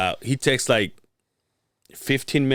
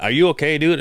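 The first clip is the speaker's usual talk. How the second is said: mildly irritated, higher-pitched challenge; a touch louder, impatient; annoyed check; 6 semitones higher, 6 dB louder than usual. Retold or imitated. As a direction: retell it as a testy, raised question.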